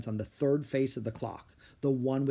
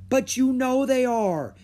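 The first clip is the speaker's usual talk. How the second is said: high, loud protest; crying out; 11 semitones higher, 7 dB louder than usual. A narrator's reading. Son to mother who denies dragons are real, crying out. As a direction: insist desperately at full voice. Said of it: "he cried".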